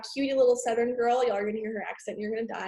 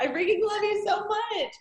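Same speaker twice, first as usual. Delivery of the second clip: high, laughing squeal of love; affectionate gushing; 9 semitones higher than usual. Real, not acted. Host telling her teammate she loves her, gushing. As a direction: gush it high through a laugh.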